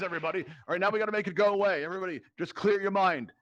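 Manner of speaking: ordinary delivery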